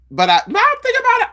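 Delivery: high, fast burst of realization; catching a thought, high and quick; sudden realization. Real, not acted. Realizing her sister told the friend, high and fast.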